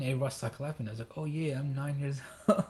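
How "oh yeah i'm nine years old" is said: breaking into a laugh near the end; amused retold reply. Retelling his reply to clapping classmates, laughing. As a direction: laugh through the last words.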